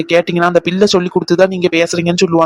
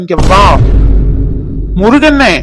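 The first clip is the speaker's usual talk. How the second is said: calling out; 5 dB louder than usual. Acted. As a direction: yell across the house. Calling servant loudly.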